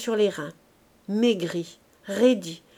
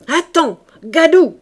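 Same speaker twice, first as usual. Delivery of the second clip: higher, louder threat; yelling threat; 5 semitones higher, 9 dB louder, slower than usual. A narrator's reading. Gervaise yelling at Virginie as she grabs a bucket, loud threat. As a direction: yell it as you grab the bucket.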